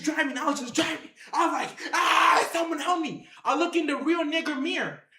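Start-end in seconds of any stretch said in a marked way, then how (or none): 1.9-3.3 mock scream for rescue; strained, imitated terrified yell; panicked cry for help. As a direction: act out calling for help in a panic. Acted cry for help driving.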